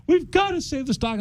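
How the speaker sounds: high-pitched imitation of an upset wife; pleading wife. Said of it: "My wife was like".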